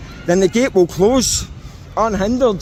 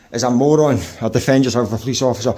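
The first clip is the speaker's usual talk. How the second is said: usual speaking voice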